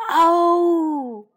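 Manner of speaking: loud high dinosaur roar; small dinosaur's battle roar. Acted. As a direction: roar as you charge. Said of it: "梁太吼叫着".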